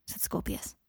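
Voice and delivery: ordinary delivery, nothing marked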